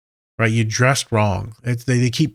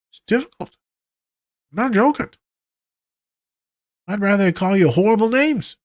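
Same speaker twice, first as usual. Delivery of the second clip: high comic whine; 12 semitones higher than usual. Real, not acted. Therapist joking about his meanness, high whiny voice. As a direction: voice pushed high in mock despair.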